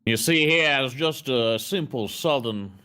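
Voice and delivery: lightly done Southern lawyer drawl; slow, hint of Southern courtroom; Southern accent. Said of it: "you're gonna be a Southern lawyer".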